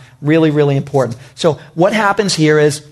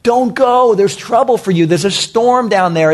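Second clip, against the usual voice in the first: higher-pitched mock panicked plea; alarmed pleading; 5 semitones higher than usual. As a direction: imitate frightened friends begging him to stay. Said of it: "and they're like".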